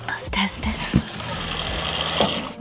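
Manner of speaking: whispered, slow aside; whispering. Real, not acted. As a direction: whisper it to yourself. Quiet aside before test.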